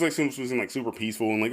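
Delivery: ordinary talk, nothing marked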